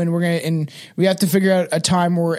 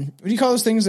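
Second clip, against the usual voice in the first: normal talking voice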